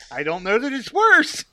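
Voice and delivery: high, loud amused objection; amused protest. Real, not acted. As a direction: pitch it up loudly, fresh from laughing. Laughing disagreement over worst start.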